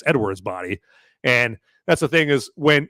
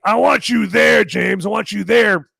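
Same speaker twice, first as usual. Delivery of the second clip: high, loud mock yell; imitated calling out; 9 semitones higher, 5 dB louder than usual. Prisoner dragged off calls to James, mock shouting. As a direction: call it out like a man being hauled away.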